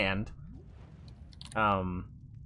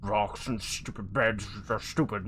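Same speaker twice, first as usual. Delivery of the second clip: grumbling; 3 semitones lower, 4 dB quieter than usual. Acted. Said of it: "you just grumble about".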